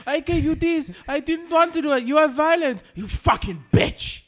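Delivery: mock rant. Quoting the man's rant.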